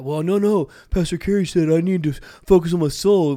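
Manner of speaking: mock a lazy guy dodging blame; whiny excuse voice. Mocks a manipulative listener's excuse.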